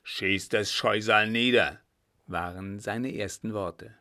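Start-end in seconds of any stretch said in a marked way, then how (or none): 0.0-1.8 weak furious demand. Acted. Rescued captain demands shooting dog.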